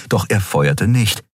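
ordinary delivery, nothing marked

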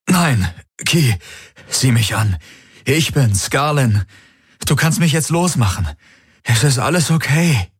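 the possessed man begs Kirai to release him; pleading man. Acted. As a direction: coax her to set him free. Valyr pleads as Garland.

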